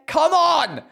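high, loud delighted cheer; excited rising-pitch outburst; thrilled exclamation. Real, not acted. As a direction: shout it out in delight. Thrilled by a song on list.

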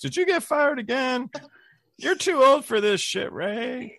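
high-pitched exasperated mom scolding; mock mad mother. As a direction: scold him like his mother, pitched well up. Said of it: "Was she mad?".

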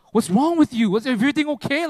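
loud, high worried questions; alarmed concern. Retold or imitated. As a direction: burst out in alarm for your friend. Alarmed friend reacts to crying.

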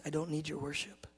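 very soft statement; God's quiet refusal. Retold or imitated. God turning down his son's worship, softly. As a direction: state it gently, far under normal volume. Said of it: "He goes".

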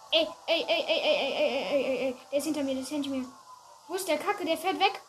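loud frantic rapid ey ey; panicking gamer. Real, not acted. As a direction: panic as the target slips away. Robber escaping during chase.

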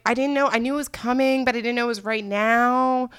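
high-pitched mock innocence; acted-out protesting; defensive excuse. Acting out her defensive excuse.

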